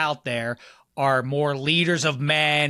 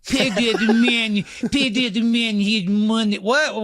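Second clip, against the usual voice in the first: put-on slow, higher mangled Malkovich accent; Malkovich impression; 7 semitones higher, 3 dB louder, slower than usual. Co-host redoing Malkovich's Rounders line, slow and higher. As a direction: stretch the words in a bad fake accent. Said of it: "Malkovich ... just outside his range".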